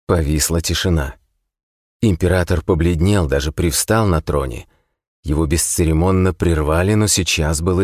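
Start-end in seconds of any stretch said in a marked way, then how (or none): none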